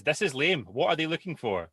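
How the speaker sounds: mock sulky daughter; hint of a whining teenage daughter; voiced daughter. Mum voices bored daughter.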